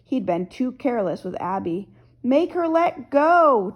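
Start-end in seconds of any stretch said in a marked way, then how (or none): 2.2-3.7 high, drawn-out holler; raised voice, stretching the words. A narrator's reading. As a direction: holler it out as a demand. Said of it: "Jasper hollered at me".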